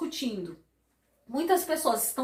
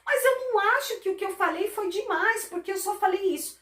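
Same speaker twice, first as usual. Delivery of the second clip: higher, defensive protest; defensive whine; 7 semitones higher than usual. Retold or imitated. Partner defending their words in a quarrel, mock whiny. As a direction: justify yourself, half-whining. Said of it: "Aí você tá falando assim".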